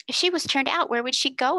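slightly worried question; a touch anxious. A narrator's reading. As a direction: ask it a little anxiously. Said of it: "inquired Jessie a trifle anxiously".

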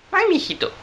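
high-pitched granny attempt; put-on old woman voice; little old lady voice. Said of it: "con su voz de viejecita".

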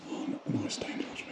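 soft unvoiced whisper. Real, not acted. Pointing out stained glass to Andy, whispering. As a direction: murmur it in quiet awe. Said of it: "Why am I whispering?".

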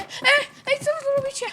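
high, loud frightened cries; panicked yelling. Pretend. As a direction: scream in panic for your friends. Panics, friends lie knocked out.

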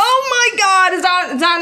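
high, loud burst of delight; excited shout. Real, not acted. Reacting to the Rex toy.

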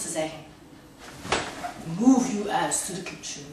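zacht en droog, met lange pauzes; ingehouden gedichtvoordracht. Acted. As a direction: laat na de uitroepen de stem zakken en draag zacht voor. Poem falls back to quiet. (zachte, ingehouden voordracht)

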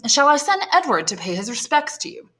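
a lady offering to send her son; lightly voiced society lady asking; Madame de Villefort voice. Madame de Villefort asks Noirtier.

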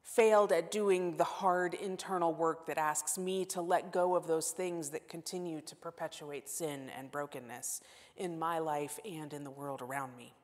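ordinary delivery

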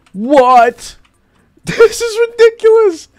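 high, loud, laughing outcry; loud laughing shout of disbelief. Real, not acted. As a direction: shriek it through a laugh. Startled by enemy attack.

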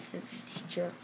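ordinary delivery

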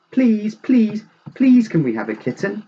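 put-on high, loud childlike pleading; eager begging. A narrator's reading. The Joneses' children beg for a kitten, eager pleading. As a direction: plead like an over-excited child.

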